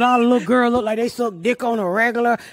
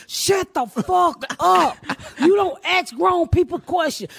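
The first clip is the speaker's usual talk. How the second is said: slow, higher shouted rebuke; mock shout; 5 semitones higher, slower than usual. Grandma shouting down her questioning granddaughter, slow. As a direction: snap at the nosy granddaughter. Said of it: "I'm like".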